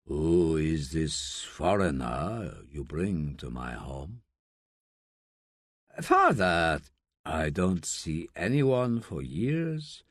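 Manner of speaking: put-on low, slow old man's voice, scornful; an ailing father mocking his son, slow and low; a slow low old man, needling; gruff old father.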